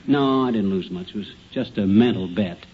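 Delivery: ordinary talk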